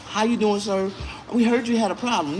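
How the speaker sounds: lightly mocking customer-service voice; manager imitation. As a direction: mimic a polite manager checking in.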